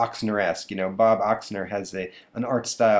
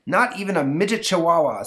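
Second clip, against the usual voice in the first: stern dad; 8 semitones higher, 5 dB louder than usual. Acted. Father refuses.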